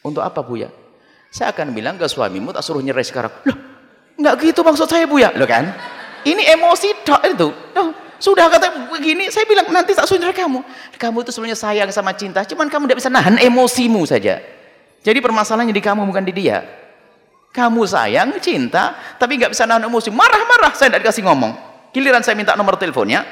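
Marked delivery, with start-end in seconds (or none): 3.5-7.5 high, loud, flustered protest; shrill-free but high alarmed denial; protesting wife. Retold or imitated. Wife loudly protesting to the preacher, pitched high. 7.8-23.2 high, loud excited storytelling; animated retelling. Retold or imitated. Preacher excitedly retelling the wife's visit.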